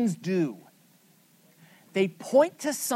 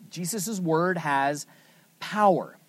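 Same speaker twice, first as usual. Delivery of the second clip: usual speaking voice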